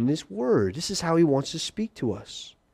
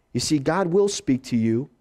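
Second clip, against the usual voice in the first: ordinary delivery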